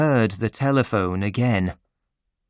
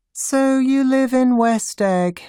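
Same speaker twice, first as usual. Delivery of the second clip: bored voice; 12 semitones higher, 3 dB louder than usual. A narrator's reading. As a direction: sound utterly uninterested. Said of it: "in a bored voice".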